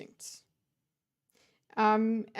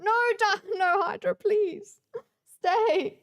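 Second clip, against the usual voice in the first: high, raised pleading call; urgent pleading; 9 semitones higher, 4 dB louder, slower than usual. Real, not acted. Breaking off from chat to beg Hydra to stay, urgent pleading.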